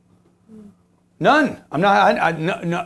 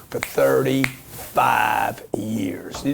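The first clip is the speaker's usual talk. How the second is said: slow whisper; 8 semitones lower, much slower than usual. Real, not acted. Marveling at long-term renter.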